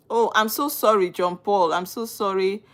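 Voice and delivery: ordinary delivery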